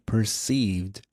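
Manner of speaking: perceived drawn out slowly for emphasis; stress on perceived. Shown as a demonstration. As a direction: lean on perceived, stretching it out. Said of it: "Emphasis on perceived".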